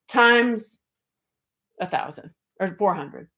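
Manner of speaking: usual speaking voice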